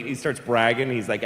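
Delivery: ordinary talk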